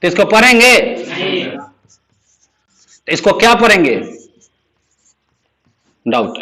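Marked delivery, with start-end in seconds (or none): 6.0-6.4 डाउट said as "daut", with no b sound; डाउट read aloud without sounding the b; silent b. Shown as a demonstration. Teacher shows the class how to say डाउट, no b. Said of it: "ऐसे पढ़ेंगे".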